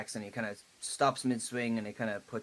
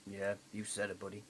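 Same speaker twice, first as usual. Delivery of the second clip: softer wry agreement; soft chuckling yeah you said it buddy; 3 semitones lower, 10 dB quieter than usual. Acted. Character agrees softly.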